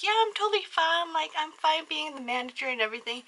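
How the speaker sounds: high-pitched breezy denial; imitating a too-cheerful brush-off; feigned fine. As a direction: insist everything is totally fine. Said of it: "tried to be like".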